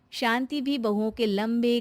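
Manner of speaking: usual speaking voice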